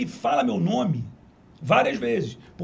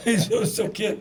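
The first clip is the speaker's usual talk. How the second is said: usual speaking voice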